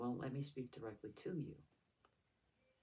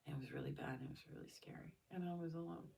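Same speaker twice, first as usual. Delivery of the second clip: fragile whisper; 5 semitones higher, 4 dB quieter, faster than usual. Real, not acted. Recounting assault, near tears.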